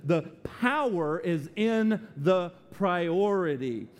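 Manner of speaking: slow, deliberate emphasis. Shown as a demonstration. Preacher to distracted listeners, said slowly. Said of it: "I'm gonna say that slow".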